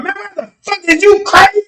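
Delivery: high-pitched retold outrage; hammed-up high indignation; mock outraged cop. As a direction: play an incensed officer, voice way up. Acts officer at car window.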